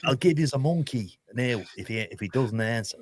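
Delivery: badly put-on Irish footballer accent, half-apologetic; terrible Damien Duff accent. Mimicking Damien Duff daring Jose on the phone, in a badly faked Irish accent. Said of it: "that's a terrible accent".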